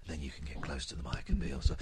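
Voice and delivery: low, soft and quick; hushed demonstration of mic technique; close-mic intimacy. Shown as a demonstration. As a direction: drop down quietly, close to the mic. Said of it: "displayed how useful it could be".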